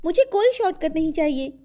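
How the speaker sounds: put-on high, quick girl's voice; defiant studious sister. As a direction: brush off a pestering brother, high and fast. Girl character brushes brother off. (भाई को झिड़कती बहन की ऊँची आवाज़)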